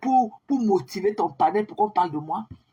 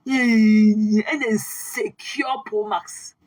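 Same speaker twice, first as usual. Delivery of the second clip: stretched out very slowly and louder, relishing it; very slow, louder taunt; 3 dB louder, much slower than usual. Acted. Repeating the insult to the rival on live, very slow and louder. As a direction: savour every syllable of the insult.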